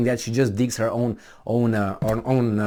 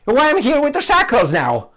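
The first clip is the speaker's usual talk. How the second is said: louder, higher mock wake-up call; sudden realization; 8 semitones higher, 4 dB louder than usual. Commenter imagining a follower waking up, higher, louder. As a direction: raise it like someone suddenly noticing his chains. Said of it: "it'd be like".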